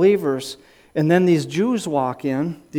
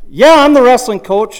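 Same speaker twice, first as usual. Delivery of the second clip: yelled reply; 7 semitones higher, 10 dB louder than usual. Retold or imitated. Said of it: "you could be fear-driven and say".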